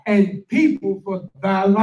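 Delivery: scripture reading. Acted. Reads scripture aloud.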